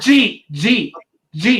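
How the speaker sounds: Jeet as one clipped syllable for did you eat; Jeet, jeet, jeet, like a Philly local; Philly slang. Shown as a demonstration. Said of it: "Philadelphia for "Did you eat?".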